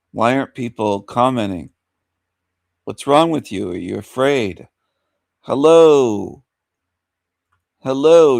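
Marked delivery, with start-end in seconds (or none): 5.4-8.4 loud hello, called twice; calling out. Real, not acted. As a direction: shout hello into the stream. Calling out to silent viewers.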